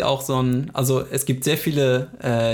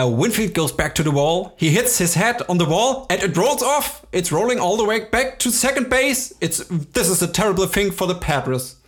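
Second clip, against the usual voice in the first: excited baseball announcer, pitched higher; home run call; 5 semitones higher than usual. Retold or imitated. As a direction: call it like a stadium announcer over the PA. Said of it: "die lese ich jetzt vor".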